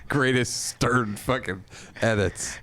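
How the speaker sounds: ordinary delivery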